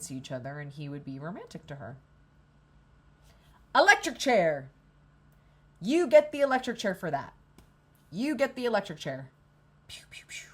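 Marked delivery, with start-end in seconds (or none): 3.7-9.3 mock verdict. Mock sentencing the waffling guy. 9.9-10.5 whispered gunshots. Pretend. Imitating gunshots.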